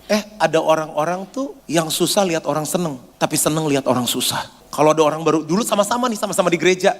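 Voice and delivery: usual speaking voice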